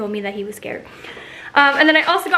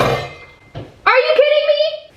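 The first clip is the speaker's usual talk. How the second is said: high-pitched, drawn-out disbelief; exasperated outburst; much slower than usual. Real, not acted. Vlogger catching a pet knocking things down, exasperated. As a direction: let your voice shoot way up, incredulous.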